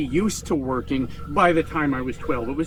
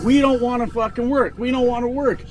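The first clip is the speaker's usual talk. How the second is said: mock scolding parent; 6 semitones higher than usual. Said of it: "getting yelled at".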